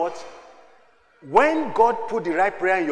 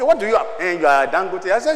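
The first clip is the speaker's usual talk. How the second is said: ordinary delivery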